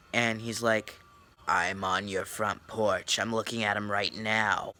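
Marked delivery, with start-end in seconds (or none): 1.5-4.7 slow, lightly menacing stranger; creepy caller voice. Retold or imitated. Vlogger imitates caller on the porch, slow mock creepy voice. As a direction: say it slow like you're watching them. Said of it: "he had a creepy voice".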